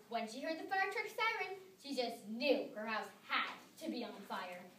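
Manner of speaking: ordinary delivery, nothing marked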